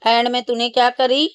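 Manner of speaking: usual speaking voice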